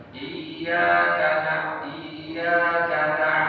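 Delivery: Iyyaka with a small push on the doubled ya, slowly; slight jolt. Shown as a demonstration. Teacher shows tasydid with a slight jolt. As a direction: give Iyyaka a little hentakan on yya. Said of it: "harus ada sedikit hentakan".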